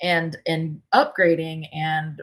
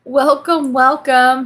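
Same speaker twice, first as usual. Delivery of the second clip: bright greeting; 8 semitones higher, 11 dB louder, much slower than usual. Real, not acted. Live stream greeting, sing-song.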